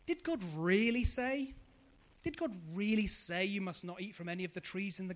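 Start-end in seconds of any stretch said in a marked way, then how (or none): 0.1-1.5 DID stressed, slow and sly; sly questioning. Retold or imitated. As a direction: lean on "Did", drawn out like a tempter. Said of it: "what that shrewd voice said".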